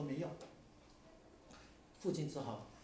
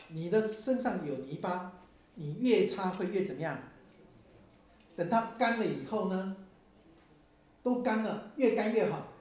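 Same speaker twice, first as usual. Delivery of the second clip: preaching at full force; 5 semitones higher, 7 dB louder than usual. Acted.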